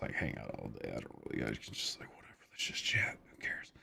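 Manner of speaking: hushed, breathy whisper. Real, not acted. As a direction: sink down into a whisper. Drops to whisper.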